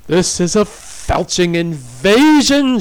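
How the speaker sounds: furious, loud snarling; angry snarl. A narrator's reading. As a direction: snarl it, rising to a shout. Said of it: "she snarled".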